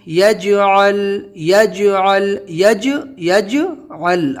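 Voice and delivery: یجعل slow, echo on ج of یج, ل stopped; یجعل میں ج پر قلقلہ; qalqala on یج. Shown as a demonstration. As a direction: bounce the ج, not the ل. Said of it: "یج میں کرنا ہے".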